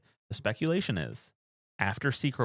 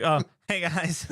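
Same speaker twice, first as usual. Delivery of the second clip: higher, mock-awkward hello; sheepish unmasked hero; 8 semitones higher, 4 dB louder than usual. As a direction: play an awkward hello to a crowd. Acting unmasked hero greeting Wakanda.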